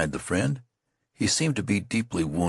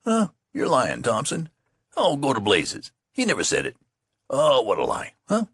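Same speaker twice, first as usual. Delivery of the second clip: higher, pleased mock disbelief; embarrassed scoffing; 8 semitones higher than usual. Youths hear their praise: embarrassed pleased scoffing. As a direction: scoff while secretly delighted. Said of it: "youthful scoffing and embarrassment".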